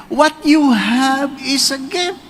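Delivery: slow, rising emphasis on you; slow emphatic sermon line; slow preaching. Shown as a demonstration. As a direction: lean on you, slowly.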